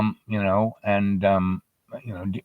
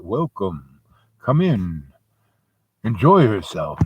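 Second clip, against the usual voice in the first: Death as host; 5 semitones lower than usual. Acted. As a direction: greet each guest with measured pauses. Death greets guests at the door.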